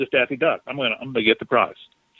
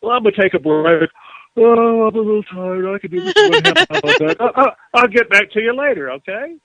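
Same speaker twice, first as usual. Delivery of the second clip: mock smug, high lazy voice; hammed-up complacent slacker; smug lazy self; 7 semitones higher than usual. Flattered [name] brushing off work, hammed-up smug voice. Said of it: "And I go".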